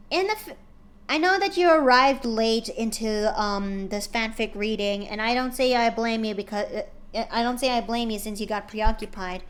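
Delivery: ordinary delivery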